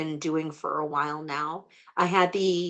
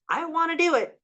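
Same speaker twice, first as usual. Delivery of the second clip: eager; 11 semitones higher than usual. Real, not acted. Eager declaration of wanting to help.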